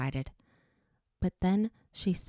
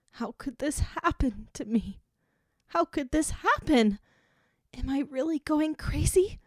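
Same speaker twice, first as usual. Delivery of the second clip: rising, tearful cry; sobbing; 9 semitones higher than usual. A narrator's reading. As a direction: let the sob burst out. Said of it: "the sob she'd been holding back burst out".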